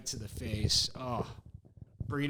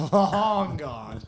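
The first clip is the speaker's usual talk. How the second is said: emphatic laughing repeat; 4 semitones higher, 7 dB louder than usual. Retold or imitated. Ball rolled far past hole.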